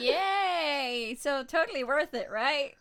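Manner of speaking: loud sarcastic yay; sarcastic cheer. Real, not acted. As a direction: fake cheer over bad news. Friend coughing from toxic fumes.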